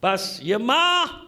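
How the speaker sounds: ordinary delivery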